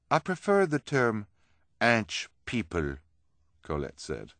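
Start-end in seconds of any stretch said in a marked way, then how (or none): 0.1-3.0 higher, louder character voice; lightly voiced storybook freak; prim correction. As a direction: correct the term slowly, pitched up. Colette corrects Hugo's term.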